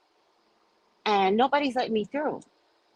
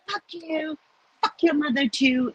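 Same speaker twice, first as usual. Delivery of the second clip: pitched-up taunting, over the top; high mock cursing; 9 semitones higher than usual. Telling off the trucker, going overboard with a high mock taunt. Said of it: "I went overboard".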